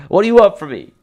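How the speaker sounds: over-the-top tearful outburst; mock anguished cry. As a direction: overplay the despair, loudly. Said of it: "you're gonna make me cry".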